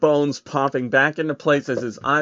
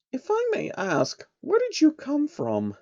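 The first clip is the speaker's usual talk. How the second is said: high, soft polite princess; gently curious pony princess; high and softer; 4 dB quieter than usual. Acted. Luna voiced.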